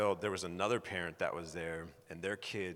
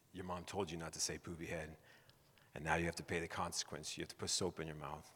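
low, softly delivered parental sentence; lightly stern dad; 3 semitones lower, 7 dB quieter than usual. Retold or imitated. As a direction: quietly lay down the punishment, a touch solemn. Retold father disciplining his daughter.

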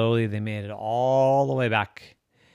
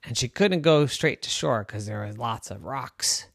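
ordinary delivery

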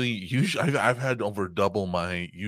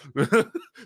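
laughing burst; 6 semitones higher, 6 dB louder than usual. Real, not acted. Laughing at his own story.

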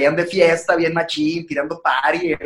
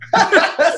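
laugh; 14 semitones higher, 3 dB louder than usual. Real, not acted. Laughing at own impression.

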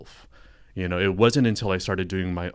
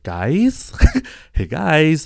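loud, high, urgent call with a laugh; 8 semitones higher, 10 dB louder, much slower than usual. Retold or imitated. Calling his friends at the hot spring, loud and laughing. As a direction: call your friends over, half laughing.

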